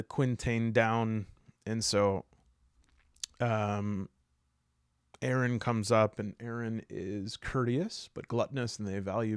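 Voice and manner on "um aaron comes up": ordinary talk, nothing marked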